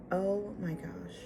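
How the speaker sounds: slow, soft exasperation; retold quiet frustration. Getting back in her car, quiet slow frustration. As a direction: draw it out softly, frustrated with yourself. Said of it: "me getting so frustrated".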